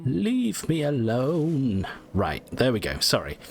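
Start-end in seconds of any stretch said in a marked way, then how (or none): 0.1-1.9 slow, drawn-out echo of "Leave me alone"; mocking slow drawl.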